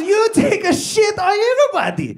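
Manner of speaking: very high-pitched dad imitation; hammed-up accented dad climax; accusing dad. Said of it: "And he goes".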